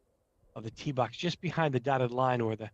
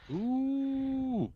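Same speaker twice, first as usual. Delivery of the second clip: surprised ooh; 13 semitones higher than usual. Real, not acted. Ball hits the rock.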